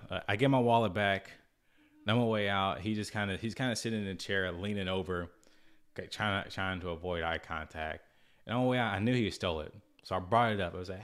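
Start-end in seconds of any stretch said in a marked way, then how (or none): none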